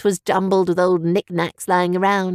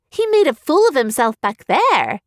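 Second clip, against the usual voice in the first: indignant burst; 6 semitones higher, 3 dB louder than usual. Acted. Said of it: "Evian burst".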